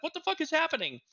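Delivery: high, exasperated outburst; frustrated. Retold or imitated. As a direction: demand answers from a broken game. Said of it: "I'm like".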